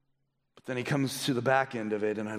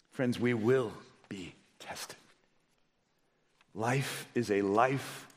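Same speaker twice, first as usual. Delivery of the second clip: closing appeal; 2 semitones lower than usual. Real, not acted. Preacher asking heads bowed, hushed closing appeal. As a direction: bring the congregation gently into prayer, weighing each phrase. Leads congregation into closing prayer.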